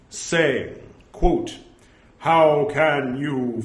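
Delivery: deliberate, ceremonious reading aloud; formal scripture recitation. Acted. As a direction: declaim each quote, announcing the punctuation.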